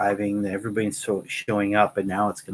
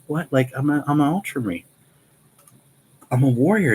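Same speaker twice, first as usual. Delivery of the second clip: put-on higher and louder, offended young warrior; mock outraged Ultramarine; indignant protest; 6 semitones higher, 4 dB louder than usual. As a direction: bristle at being made a clerk. Recruit protests to librarian.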